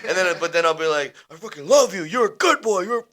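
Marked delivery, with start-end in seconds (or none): 1.3-3.0 mock scolding tone. Shown as a demonstration. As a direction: praise delivered like a raised-pitch telling-off. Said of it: "Yeah, man, I'm in trouble".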